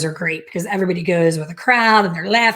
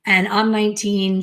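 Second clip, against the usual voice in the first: ordinary delivery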